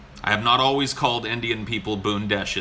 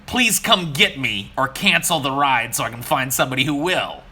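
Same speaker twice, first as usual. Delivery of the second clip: exasperated; 4 semitones higher, 5 dB louder than usual. Retold or imitated. Fed-up rider arguing with driver.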